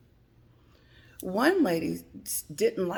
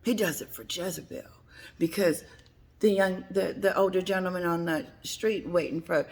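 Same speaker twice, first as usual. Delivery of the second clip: normal talking voice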